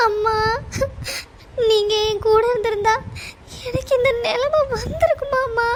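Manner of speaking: tearful, breaking sob on the first word; crying for mother. Acted. Grieving daughter calls for her mother, crying. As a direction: cry out to her absent mother, pitched high. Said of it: "இப்படி நீ அழுதுட்டு இருக்க".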